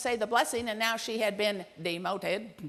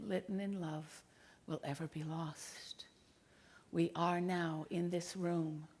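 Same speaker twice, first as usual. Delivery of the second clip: hushed, low, tender closing; solemn reflection; 4 semitones lower, 6 dB quieter than usual. Real, not acted. Speaker leaving her daughter's prayer with the audience, solemn and hushed. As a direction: speak softly and low, with long pauses.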